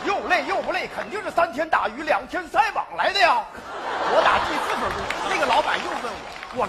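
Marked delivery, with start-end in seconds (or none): 0.9-3.4 laughing shout. Acted. Comic explanation, shouted.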